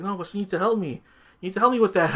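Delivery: high-pitched mock insistence; imitated pleading. Lady with the rat begging the boss, imitated pleading. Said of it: "He's like".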